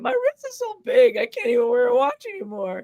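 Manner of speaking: high-pitched comic wail; mock crying. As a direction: whine it out as a hammed-up sob. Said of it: "he starts crying".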